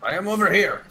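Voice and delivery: high, odd character voice; high put-on voice. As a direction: answer like a silly character. Answers call in put-on voice.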